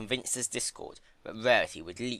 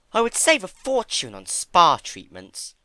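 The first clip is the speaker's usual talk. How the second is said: higher, louder, delighted; dreamy Rarity; 10 semitones higher, 8 dB louder than usual. Acted. Rarity daydreaming to Fluttershy, higher and delighted. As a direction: savor the luxury.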